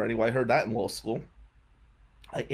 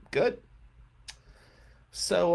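usual speaking voice

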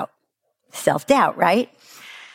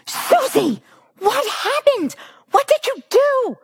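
screaming mother. Retold or imitated. Hannah screams at her daughter over the mess, shrieking. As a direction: scream the questions at the child. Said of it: "and screams".

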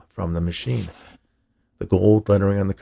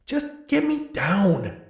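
demanding; 13 semitones higher, 3 dB quieter, much slower than usual. Acted. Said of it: "Hal demanded".

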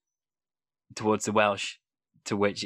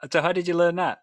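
laughing question; 7 semitones higher, 4 dB louder, faster than usual. Real, not acted. Laughing after Welsh joke.

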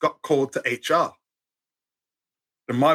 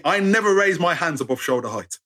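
normal talking voice

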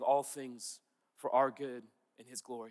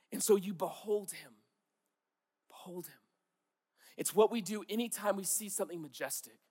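ordinary delivery

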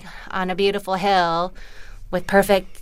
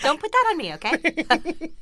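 mock exasperated husband; 8 semitones higher than usual.

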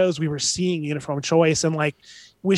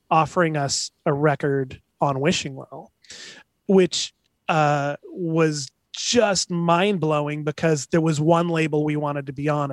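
ordinary talk

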